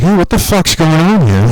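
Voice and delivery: high startled outburst. Retold or imitated. As his mouse moves by itself, a high startled cry. Computer hijacked by the FBI.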